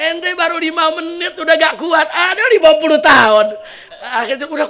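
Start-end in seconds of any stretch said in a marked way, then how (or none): 0.1-3.5 loud, high boastful comeback; husband shouts back at the jinn about his fifty years; shouted retort. Retold or imitated. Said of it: "dijawab ini orang".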